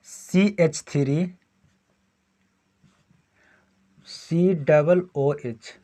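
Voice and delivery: each part drawn out very slowly; slow dictation. Shown as a demonstration. Teacher dictating a formula to students, very slowly. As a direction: spell CH3 COOH out bit by bit. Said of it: "teacher dictates formula slowly".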